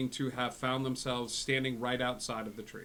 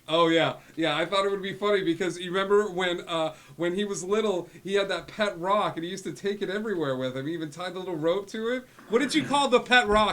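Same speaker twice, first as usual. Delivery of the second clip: high, loud, gushing dad; chatty excited dad; 8 semitones higher, 10 dB louder than usual. Acted. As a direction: reminisce loudly as a proud, embarrassing dad. Plays father on sending stone.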